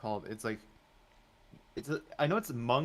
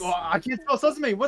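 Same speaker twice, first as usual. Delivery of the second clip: exasperated outburst; 12 semitones higher, 8 dB louder than usual. Real, not acted. Exasperated at own spelling.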